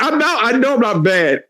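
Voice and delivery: laughing through it; can't stop laughing while defending himself. Real, not acted. Defending himself to listeners, laughing. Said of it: "laughing at a tragedy".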